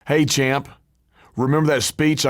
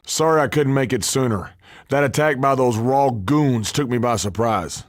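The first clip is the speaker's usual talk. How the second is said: ordinary delivery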